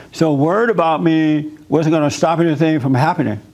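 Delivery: ordinary talk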